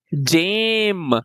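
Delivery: "जेम" stretched very slowly, long A; slow blending. Shown as a demonstration. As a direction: sound out "जेम" slowly for kids. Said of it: "ई becomes silent".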